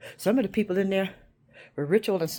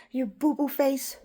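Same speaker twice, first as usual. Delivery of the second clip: put-on childish taunt; 4 semitones higher, slower than usual. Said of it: "when you say".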